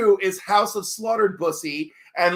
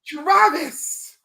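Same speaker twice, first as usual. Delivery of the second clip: excited greeting; 8 semitones higher, 3 dB louder than usual. Real, not acted. Calling out greeting to arriving viewer.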